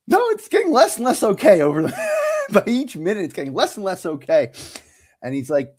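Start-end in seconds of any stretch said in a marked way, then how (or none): 0.1-4.5 high, fast, half-laughing yell; laughing protest to the acupuncturist; laughing mock protest. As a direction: protest through laughter, hammed up. Protesting growing pain.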